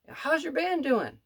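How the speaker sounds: a lightly voiced high, slow old-lady question; sweet old lady. Pianist asking the waiter about his band in a high sweet voice. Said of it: "she was just such a sweet old lady".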